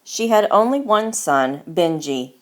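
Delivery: ordinary delivery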